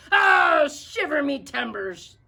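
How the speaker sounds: loud, high pirate yell; pirate shout. A narrator's reading. Captain Pete shouting at the rascal, loud and high. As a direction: bellow it like an angry captain. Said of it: "shouted Pete".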